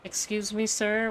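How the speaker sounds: ordinary talk, nothing marked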